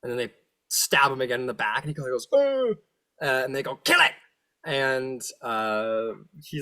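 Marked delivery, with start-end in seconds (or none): 2.3-2.7 high pained devil whimper. Acted. Stabbed in the back. 3.8-4.1 high, loud devil command; high shouted kill order; shrieking order. Acted.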